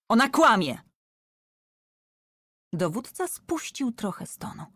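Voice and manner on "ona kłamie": loud high accusing shout. A narrator's reading. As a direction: shout that she lies. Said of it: "krzyknął więzień". (głośny, oskarżycielski krzyk)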